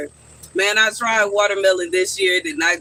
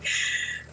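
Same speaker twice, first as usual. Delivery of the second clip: breathless laugh; 10 dB quieter than usual. Real, not acted. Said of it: "I'm crying laughing".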